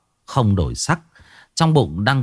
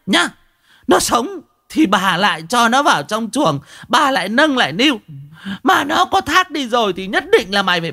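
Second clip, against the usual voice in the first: high, forceful ultimatum; scolding woman turned hard and commanding; steely, hard voice; 13 semitones higher than usual. A narrator's reading. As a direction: lay down threats relentlessly in a high voice. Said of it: "giọng của mụ lại đanh thép".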